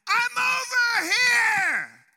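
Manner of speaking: very loud, high, slow call; yelling back loud. Retold or imitated. Answering a lost stranger's cry, long drawn-out loud yell. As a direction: shout it out as loud as you can to reach them. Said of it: "I yelled back as loud as I could".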